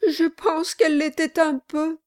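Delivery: high, mildly tearful voice. A narrator's reading. Widow answers the lawyer, tearful. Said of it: "avec un sanglot".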